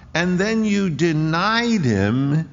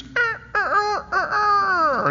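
choked stammer; slower than usual. Retold or imitated. Peter hears his own denial.

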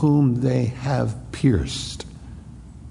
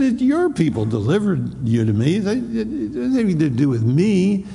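high scornful taunt at a beaten prisoner; mocking Pilate; 7 semitones higher than usual. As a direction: sneer at him, much higher. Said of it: "He said".

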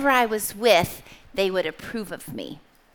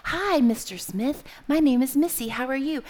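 put-on higher-pitched, well-drilled child's greeting; rehearsed polite greeting; 4 semitones higher than usual. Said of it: "Here's what I want you to do".

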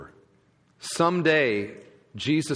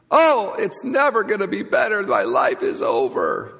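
melodramatic whine; 6 semitones higher than usual. Retold or imitated. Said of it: "get sort of melodramatic about things".

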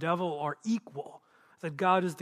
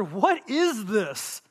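slow, wondering question; amazed; much slower than usual. Acted. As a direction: act out a crowd's amazement, drawn out. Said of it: "The people were all amazed".

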